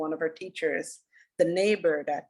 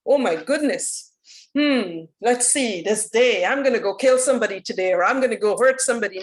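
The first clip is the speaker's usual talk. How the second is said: higher, louder mock inner voice of someone planning harm; mock villain musing; 4 semitones higher, 6 dB louder than usual. Imagined person waking up deciding to hurt somebody, higher and louder mock musing. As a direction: play a cartoonish would-be killer thinking aloud, hammed-up.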